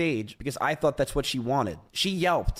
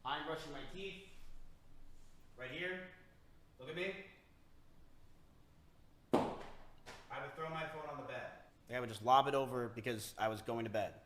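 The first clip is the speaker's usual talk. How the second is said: distant, off-mic demo; walk away from the mic; far from mic; 16 dB quieter, faster than usual. Shown as a demonstration. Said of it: "I will throw you and show you an example".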